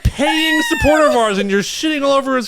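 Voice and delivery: high, loud mock-scolding through laughter; mock outraged scolding. Real, not acted. Mock-scolding a friend for insulting a supporter's country, high and loud. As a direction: scold playfully at full volume. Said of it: "mock scolding".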